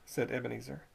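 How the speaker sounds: plain reading voice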